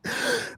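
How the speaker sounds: short, quiet sharp gasp; imitated shocked gasp. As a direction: mimic a shocked intake of breath. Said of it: "She went".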